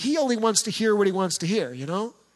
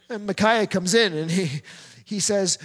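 normal talking voice

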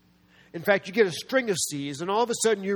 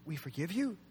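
tentative questioning; 10 dB quieter than usual. Acted. Preacher puts the answer back to the congregation, soft questioning. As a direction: ask it softly, lifting on YOU.

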